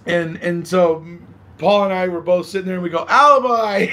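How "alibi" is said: calling out. Retold or imitated. Calling out band name at show.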